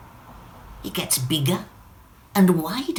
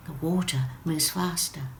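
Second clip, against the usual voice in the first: usual speaking voice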